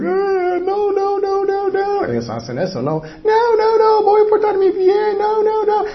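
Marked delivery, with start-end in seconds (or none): 0.0-2.0 very high mock tantrum squeal; whining child. Preacher imitating children dodging punishment, high whiny mock plea. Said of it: "los hijos están pensando". 2.8-5.8 sustained, very high pleading falsetto; hammed-up kid begging off punishment; whining child. Preacher imitating children promising parents to behave, high mock whine. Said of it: "los hijos están pensando".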